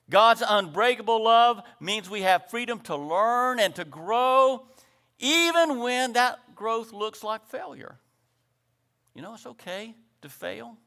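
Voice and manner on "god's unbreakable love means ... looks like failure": raised, drawn-out preacher's voice; emphatic preaching. Acted. Preacher assuring flock they are free to grow, emphatic preaching. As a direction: lift the voice and stretch the key words.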